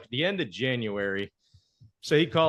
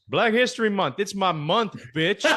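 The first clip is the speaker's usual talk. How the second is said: higher-pitched mock boast; emphatic trash talk; 8 semitones higher, 3 dB louder than usual. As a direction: punch out the taunt as a punchline. Retold punchline of trash talk.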